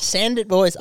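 high, loud shout to the lads; rowdy call-out. Acted. Host rallying the boys, rowdy call-out.

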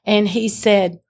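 normal talking voice

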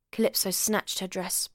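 ordinary delivery

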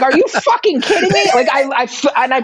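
high-pitched incredulous laugh; laughing disbelief. Retold or imitated. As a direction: shriek it in amused disbelief. Repeats outrage laughing.

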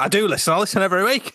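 high-pitched indignant mimicry of sister; having a go. Host imitating his sister telling him off, indignant. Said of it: "she had a right go at me".

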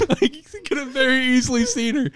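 high burst of laughter into words; real laughter; laughing. Real, not acted. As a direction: crack up, then push through. Laughing at the hidden stalker.

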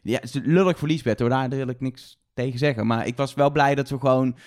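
ordinary talk, nothing marked

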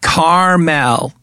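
caramel stretched slowly into "CAR-MEL"; slow two-syllable caramel, CAR-MEL with a clear "mel"; drawn-out caramel. Real, not acted. Host winds up co-host, caramel slowly as CAR-MEL. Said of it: "You trying to say caramel?".

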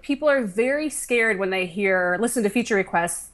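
normal talking voice

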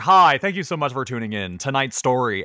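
mock news anchor greeting viewers, lightly put on; Oprah voice. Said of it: "it's the Oprah voice".

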